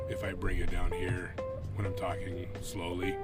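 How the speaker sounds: ordinary talk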